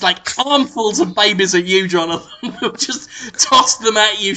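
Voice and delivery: ordinary talk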